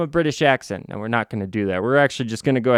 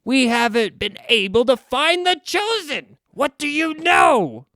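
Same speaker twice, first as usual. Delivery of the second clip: much higher, upset demand; indignant Trey; 11 semitones higher than usual. Acted. Trey demanding answers from the villain, indignant and higher. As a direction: press him, upset and indignant. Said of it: "Trey gets very upset, very indignant".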